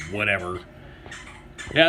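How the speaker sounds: ordinary delivery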